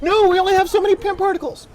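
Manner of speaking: high mocking whine; mock whiny hero. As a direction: quote them in a high squeaky mock voice. Mocking the characters' complaint.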